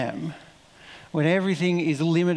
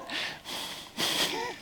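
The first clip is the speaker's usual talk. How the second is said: high breathy laugh of disbelief; incredulous laugh; 9 dB quieter than usual. Real, not acted. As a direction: laugh at the cheek of it. Laugh after question.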